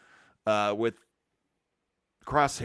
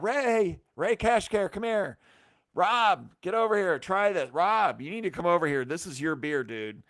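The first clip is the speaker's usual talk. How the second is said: much higher, calling out across the tables; calling everybody over; 7 semitones higher than usual. Retold or imitated. Calling friends over to taste the beer, excited shouts. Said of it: "I started calling everybody up".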